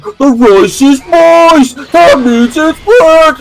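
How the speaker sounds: high, slow mock pronouncement; Napoleon. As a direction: declare it in a hammed-up high voice. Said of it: "Napoleon's like".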